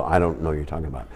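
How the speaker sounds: deadpan denial. Retold or imitated. Daughter denying, deadpan kid voice.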